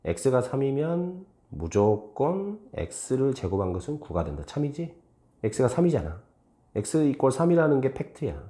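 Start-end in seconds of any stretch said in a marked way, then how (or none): 0.1-4.4 slow, 무조건 drawn out between pauses; measured demonstration with 무조건 held long; model reading with 무조건. Shown as a demonstration. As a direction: read slowly, spacing out 무조건. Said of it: "무조건을 넣어서 읽으라고".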